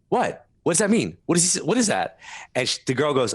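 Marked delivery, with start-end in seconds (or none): 0.1-2.2 higher-pitched, baffled questions; retold bafflement. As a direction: act out not getting the joke. Retelling his baffled questions to cousins.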